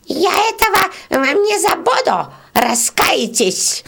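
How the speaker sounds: very high, slow parting threat; shrill shout. Acted. Beetle shrieks a threat at the peasants as she flees. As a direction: shriek it while running off. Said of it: "крикнула".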